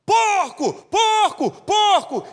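high, loud repeated yell; shouted warning. Retold or imitated. Driver yelling a warning to the other driver, loud shout. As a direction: holler it out the car window. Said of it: "gritou assim".

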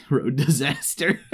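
said through a laugh. Real, not acted. Repeats phrase with a laugh.

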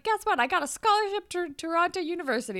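high, quick imitation of sharing big news; mock excited announcement. Paige announces scholarship to friends.

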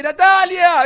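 sudden high yell of a name; retold scream. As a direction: shout the wife's name in fright. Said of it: "salté y grité".

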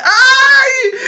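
very high, loud excited yelp; excited cry. Real, not acted. Sister eager to tell her birthday story, high excited yelp. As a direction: burst out with a high squeal of excitement.

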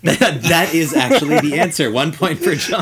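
high, loud, through laughter; real laughter bubbling through the words; laughing hard. Real, not acted. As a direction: announce it while cracking up. Host laughs at the winning answer.

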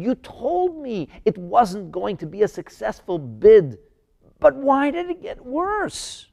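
high, pleading protest with stretched questions; upset challenge. Acted. Moses confronting God over his people's suffering, upset and pleading. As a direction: plead indignantly, pitch climbing high. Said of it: "what was he upset about?".

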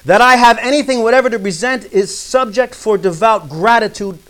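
loud, high opening, slowing to the close; declaimed quote reading. Acted. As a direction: proclaim it, then settle on the last phrase. Reads a quotation aloud.

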